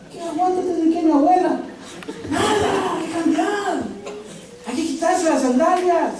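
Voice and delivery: high, laughing, scoffing put-down; mocking retort. Real, not acted. Preacher scoffs at the stubborn spouse, high laughing ridicule. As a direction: laugh off the excuse and scold.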